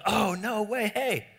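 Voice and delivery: high, excited "Oh, no way"; starstruck friend. Retold or imitated. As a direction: gush like meeting a celebrity. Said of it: "your friend looks over and goes".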